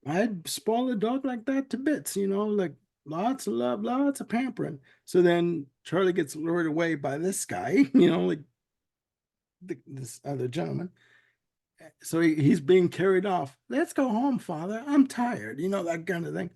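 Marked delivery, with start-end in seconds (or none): 0.1-4.8 Southern gentleman. Retold or imitated. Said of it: "this other Southern gentleman comes in and says". 13.7-15.6 lightly high, pampered dog voice. Retold or imitated. Charlie playing pampered pet to his new owner, lightly high. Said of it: "I didn't mean to do the voices".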